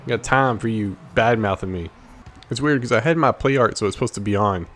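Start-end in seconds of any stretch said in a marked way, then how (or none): none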